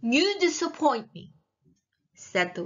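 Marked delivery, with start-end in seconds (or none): 0.0-1.3 higher-pitched, voiced condemnation; disapproving witch. A narrator's reading. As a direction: pass judgement aloud on the princess. Witch passes judgement aloud.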